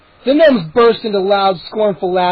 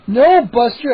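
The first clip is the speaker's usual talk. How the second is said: shriek; 5 semitones higher, 5 dB louder, slower than usual. A narrator's reading. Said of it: "I shrieked".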